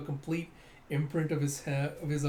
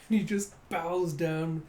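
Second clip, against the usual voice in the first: plain reading voice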